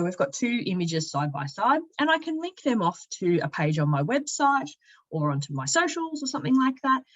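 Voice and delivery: ordinary talk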